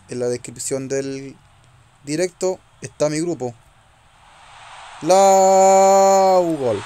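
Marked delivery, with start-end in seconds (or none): 5.0-6.8 long loud high call; goal shout. Real, not acted. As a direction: stretch the name out like a goal call. Calling out a goal.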